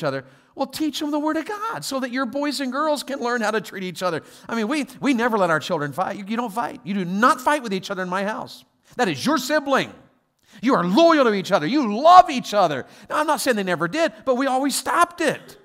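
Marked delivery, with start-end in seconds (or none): 6.2-12.8 loud, emphatic telling-off; stern parent. Acted. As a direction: lay down the house rule to squabbling children. Parent scolding fighting children, acted.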